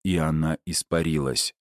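ordinary delivery, nothing marked

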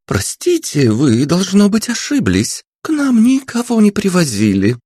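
a sweet receptionist denying everything; polite receptionist. A narrator's reading. Receptionist politely tells detectives nobody arrived. Narrator voices female receptionist.

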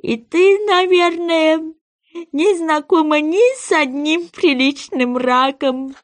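high, slow storybook sea-creature voice; plaintive goby. Acted. Goby asks Alice about life under the sea, plaintive. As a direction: draw the words out high and wistful.

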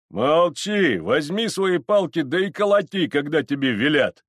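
loud, high order from tipsy elders. Acted. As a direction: shout down an underling. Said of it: "отвечали подгулявшие старшины".

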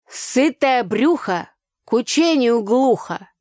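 slow, loud, high proverb; folksy proverb. Acted. Tanya answering with a proverb, slow. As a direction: lay down folk wisdom. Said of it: "ответила Таня".

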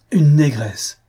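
loud, high, outraged cry of disgust; scornful exclamation. A narrator's reading. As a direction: shriek it out, appalled. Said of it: "s'écria madame de Langeais".

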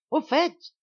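loud, very high insistent repeat; furious interruption. A narrator's reading. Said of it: "interrompit furieusement le duc". (insistance furieuse, voix haut perchée)

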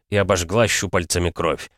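usual speaking voice

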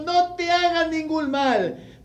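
slow, loud, high cry of warning. Acted. Paul crying out to the jailer to stop him. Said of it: "Mas Pablo clamó a gran voz".

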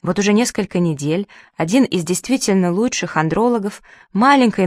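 usual speaking voice

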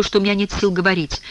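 usual speaking voice

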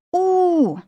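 long, high "oo" howl; wounded she-wolf howl. A narrator's reading. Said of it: "взвыла я, как раненая волчица". (воет как раненая волчица)

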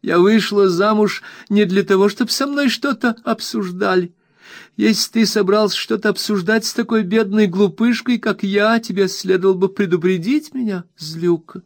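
very high reproachful complaint; very high hurt wife's reproach; wife's tearful reproach. A narrator's reading. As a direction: scold back up high, hurt and petulant. Dora's continued outburst.

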